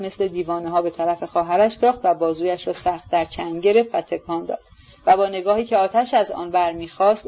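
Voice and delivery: ordinary delivery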